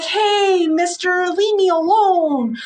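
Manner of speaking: put-on high, slow girly plea; timid girl. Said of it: "usually girls are like".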